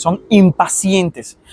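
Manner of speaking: impacientes said very slowly and drawn out; stress on impacientes. Shown as a demonstration. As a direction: lean on impacientes, stretching it. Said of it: "no son pacientes". (impacientes lento y marcado)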